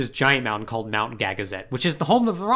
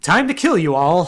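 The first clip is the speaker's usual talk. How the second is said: laughing villain line; 4 semitones higher, 6 dB louder than usual. Retold or imitated. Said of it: "And he's like".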